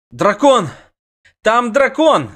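high loud cry of alarm; alarmed shout. A narrator's reading. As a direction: shout the news in panic. Scout announces the dragon.

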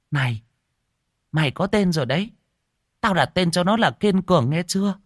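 put-on high, quick, eager old woman announcing; grandma bursting in with good news; excited calling out. A narrator's reading. Old woman tells madwoman the baby's name, excitedly high.

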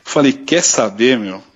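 ordinary delivery

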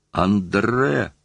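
narrated cry of despair. A narrator's reading. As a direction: cry out the name in restrained despair. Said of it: "кричал я в отчаянии".

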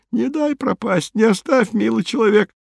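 high, plaintive whine; babbled pleading. A narrator's reading. Rescued man climbing onto his rescuer, babbled plea. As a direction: beg in a high, pitiful voice. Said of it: "бормотал он".

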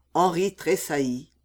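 plain reading voice

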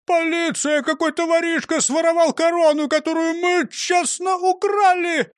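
high, loud cry for help; indignant, high-pitched hollering; yelling. A narrator's reading. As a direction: scream for the police in outrage. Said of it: "И тут он завопил".

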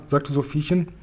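ordinary delivery

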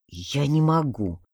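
slow, soft, clenched reply; through gritted teeth. A narrator's reading. Necromancer refusing to summon the dead, slow clenched mutter. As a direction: grit it out quietly. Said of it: "сквозь зубы ответил Ромашка".

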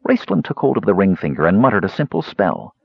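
usual speaking voice

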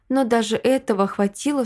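plain reading voice

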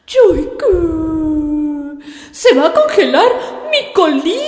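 high, loud tearful begging; crying plea. Acted. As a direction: plead through tears, voice breaking. Mara begs, crying.

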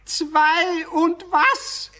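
loud, high, slow shout into a bad line; shouting into phone. [name] yells at a caller he can't hear, loud. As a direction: yell the question down the phone.

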